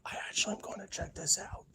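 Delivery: real hushed whisper; wary whisper. Real, not acted. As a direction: whisper it before going to investigate. Warily going to investigate noise.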